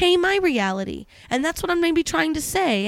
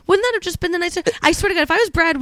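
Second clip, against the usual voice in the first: higher, whiny, aggrieved pleading; why are you being so mean to me; a real, upset whine; hurt complaint; 6 semitones higher than usual. Real, not acted. Wife complains husband argued.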